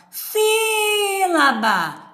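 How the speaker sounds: "sílaba" with a very long, high SÍ; SÍÍÍ-la-ba, high and stretched out; stress on SÍ. Shown as a demonstration. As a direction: hold the SÍ of "sílaba" like a distant call. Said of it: "onde tá a tonicidade nessa palavra".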